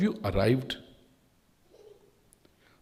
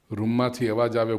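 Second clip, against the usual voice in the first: ordinary talk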